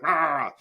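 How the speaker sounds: mock attack-dog roar; pit bull snarl. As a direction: imitate a pit bull lashing out. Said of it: "like a fucking pit bull".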